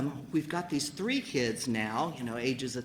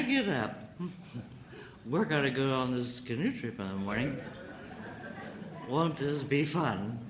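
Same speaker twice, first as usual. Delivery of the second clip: put-on drunk husband excuses; 3 dB quieter than usual. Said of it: "and he says".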